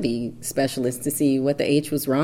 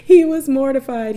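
much higher, louder, laughing it out; 12 semitones higher, 8 dB louder than usual. Real, not acted. Telling viewers about husband at the ultrasound, laughing loudly. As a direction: crack up at how embarrassed he was.

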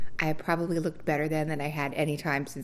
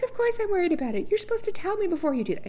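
very high, fast and louder protest; indignant outburst; 6 dB louder, faster than usual. Retold or imitated. Scolding the gynecologist, high, fast and indignant. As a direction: rattle off the outrage. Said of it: "I'm like".

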